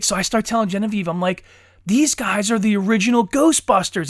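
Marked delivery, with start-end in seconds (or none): 1.9-4.1 louder, higher excited explaining; retold excitement. Dad telling his daughter about the cast, excited. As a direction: tell it like thrilling news to a child. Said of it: "and I'm all excited".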